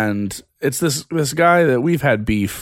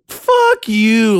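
high, loud retold cursing; retold angry outburst; 14 semitones higher, 7 dB louder than usual. Retelling a Facebook fight to co-host, angry outburst. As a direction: re-enact snapping at him, pitched way up. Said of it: "I got really upset".

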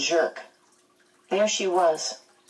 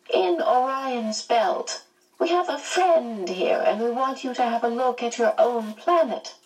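higher, lilting character voice; reassuring old friend; 6 semitones higher than usual. A narrator's reading. Missus Watson excusing the mistake to Charles, in a high, reassuring lilt. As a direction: explain it away soothingly, pitch swooping up.

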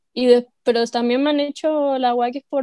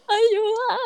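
weak mock plea; 8 semitones higher, 4 dB quieter than usual. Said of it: "Y yo como".

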